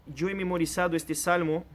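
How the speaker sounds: ordinary delivery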